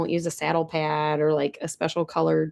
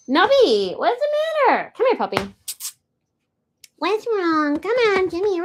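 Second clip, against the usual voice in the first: puppy talk; 12 semitones higher than usual. Real, not acted. To the whining puppy Nubby, high coaxing baby talk. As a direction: coax the whining puppy over.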